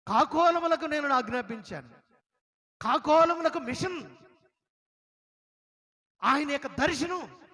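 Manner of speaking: loud, high preaching voice, pressing the story home; preaching at full force. Acted. Preacher telling the congregation of his tears, in a loud, high voice. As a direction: deliver it like a fiery preacher.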